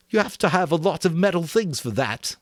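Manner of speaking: put-on high, earnest fat boy voice; a fussy, eager boy explaining; Piggy voice. A narrator's reading.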